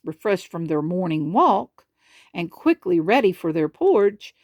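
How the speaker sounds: usual speaking voice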